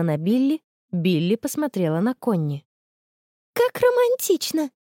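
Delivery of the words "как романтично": very high, strained and slow reaction; forced out with difficulty. A narrator's reading. Friend struggles to praise the photo news, forced. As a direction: squeeze out feigned delight with effort. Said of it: "с трудом выдавила та".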